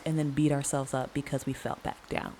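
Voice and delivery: usual speaking voice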